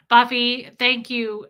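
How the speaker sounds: normal talking voice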